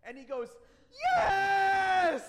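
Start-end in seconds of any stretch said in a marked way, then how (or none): 1.2-2.2 very high, full-out shout; imitated excited child. Three-year-old son hearing it's church today, shouting excitedly. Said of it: "that's a three-year-old".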